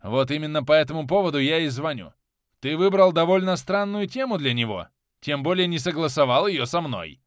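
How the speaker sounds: mildly irritated boss, pushing the voice a little; slightly irritated. A narrator's reading. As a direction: reprimand with a touch of annoyance. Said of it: "немного раздражённо ответил мне Зимин". (немного раздражённо)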